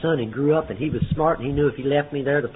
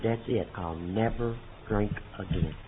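slow, much softer, lower vow; solemn quiet vow; 6 semitones lower, 9 dB quieter than usual. Retold or imitated. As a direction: swear it off quietly, meaning every word. Solemn vow after seeing wreck.

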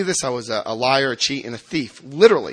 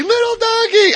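put-on high delighted old lady cry; 11 dB louder than usual. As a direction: coo his name like a fond neighbor. Neighbor greeting again.

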